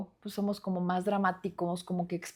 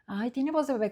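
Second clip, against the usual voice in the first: higher, mocking sing-song; 4 semitones higher than usual. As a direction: tease him, make fun of the baby voice. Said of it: "como burla". (en tono de burla, más agudo)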